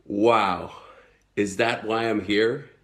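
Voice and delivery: normal talking voice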